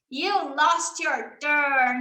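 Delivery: You lost your turn said very slowly; slow teacher demo. Shown as a demonstration. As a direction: spell it out slowly for a child. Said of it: "demo for a child".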